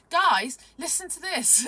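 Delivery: loud, high, slow attention call; calling out excitedly. Retold or imitated. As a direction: call the group to listen. Acted calling out to friends.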